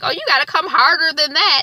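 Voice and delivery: teasing Issa. Retold or imitated. Girlfriend teases her man over his weak confession, mocking. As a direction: egg him on, unimpressed.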